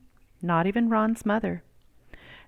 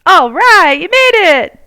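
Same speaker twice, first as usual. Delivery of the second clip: much higher and louder happy shout; calling out; 11 semitones higher, 13 dB louder than usual. Acted. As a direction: shout to a friend across the schoolyard. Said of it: "called Carl".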